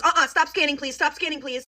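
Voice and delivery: high, sharp halt to the cashier; mom's firm interruption. Retold or imitated. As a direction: imitate a mom cutting in urgently. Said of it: "Literally just like that".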